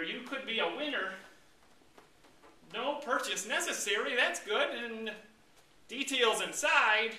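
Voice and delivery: a little giddy over a prize; hopeful reading. Acted. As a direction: label read in a lifted voice. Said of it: "what's this label say here".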